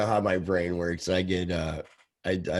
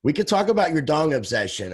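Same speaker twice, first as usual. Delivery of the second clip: teasing; 7 semitones higher, 5 dB louder than usual. Real, not acted. Teasing co-host.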